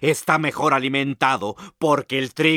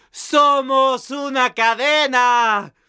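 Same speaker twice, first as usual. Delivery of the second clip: triumphant finale; 11 semitones higher, 3 dB louder, slower than usual. Acted. Final triunfal de los personajes.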